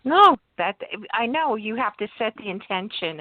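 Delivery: usual speaking voice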